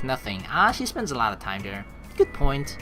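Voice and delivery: usual speaking voice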